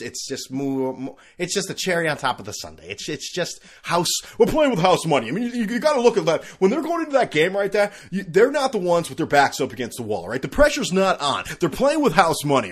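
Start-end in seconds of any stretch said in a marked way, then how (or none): 4.4-12.7 loud, high hyped-up pundit voice; loud sports-talk pundit; mock sports pundit. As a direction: ham it up like a TV analyst on the big game.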